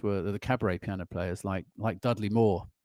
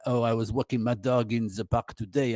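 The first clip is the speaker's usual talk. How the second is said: bad French accent. Shown as a demonstration. Musician showing how a sentence comes out, bad French accent. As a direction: put on a rough French accent. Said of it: "Even if it's a really bad one".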